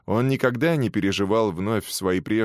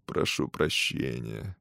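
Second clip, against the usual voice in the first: exquisitely polite; 7 semitones lower, 7 dB quieter, slower than usual. Acted. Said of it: "изысканно вежливо ответил я".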